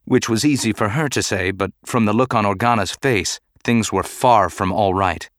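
ordinary delivery

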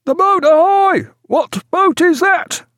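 high loud shout, slowing at the end; deck hail. A narrator's reading. Sentry on deck hails the boat, shouting. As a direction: hail the boat across the water. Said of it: "on deck the cry".

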